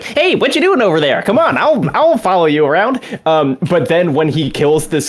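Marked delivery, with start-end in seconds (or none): 0.0-3.0 high, squeaky, chatty imitation; squeaky game character. Imitating Todo calling someone over to tag along, high and squeaky. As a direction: imitate a friendly squeaky-voiced guy, pitched way up. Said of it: "pretty kind of squeaky".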